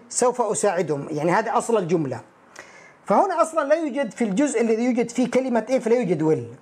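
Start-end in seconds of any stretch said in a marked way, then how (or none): none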